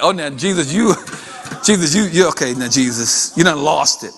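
low, chuckling dismissal; mocking disbelief. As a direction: laugh him off as if he's crazy. Mourners laughing at Jesus, acted.

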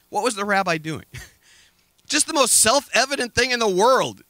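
ordinary delivery

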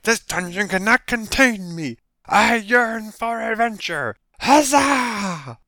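high, loud, drawn-out heroic proclamation; hammed-up fantasy hero; gung-ho adventurer. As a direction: declaim it like a cartoon champion. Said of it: "the guy like".

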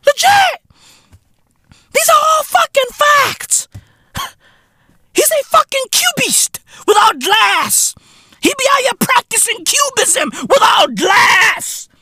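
way up in pitch, shouting; high-pitched hype shouting; high excited rant. Acted. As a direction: squeak it out, hyped. Hyped high-pitched ranting.

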